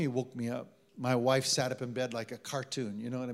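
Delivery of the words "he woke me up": usual speaking voice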